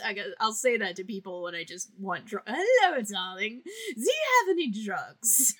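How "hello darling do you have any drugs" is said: high, theatrical diva purr; a grand dame cadging drugs; swooping high darling voice; Patricia Quinn impression. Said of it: "my favorite impression to do of her".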